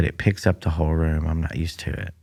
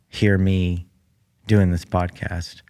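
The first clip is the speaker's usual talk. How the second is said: ordinary talk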